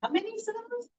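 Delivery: playful high quiz voice; teasing quiz question. Shown as a demonstration. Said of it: "Let's try again".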